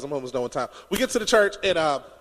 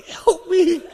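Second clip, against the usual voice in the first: mock plea for help; 11 semitones higher, 4 dB louder than usual. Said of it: "I was like".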